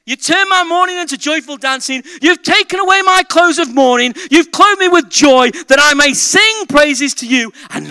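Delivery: high, loud, ringing scripture reading; fervent preacher crying out praise; proclaimed psalm. Acted. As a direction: declaim it jubilantly from the pulpit. Psalm proclaimed at full force.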